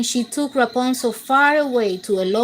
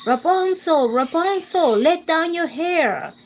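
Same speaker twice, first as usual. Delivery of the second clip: higher calling witch voice; 5 semitones higher than usual. A narrator's reading. Witch calling up to Rapunzel's window. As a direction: call up to the tower like the witch. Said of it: "heard the witch call".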